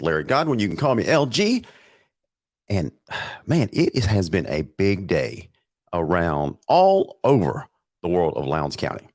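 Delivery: usual speaking voice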